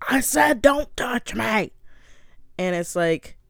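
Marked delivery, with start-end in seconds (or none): 0.1-1.7 loud, harsh, hammed-up outburst; slow, rough mock shout, hitting every word; angry yell. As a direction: repeat the demand in a raspy imitated yell. Acted quarrel, he repeats his demand.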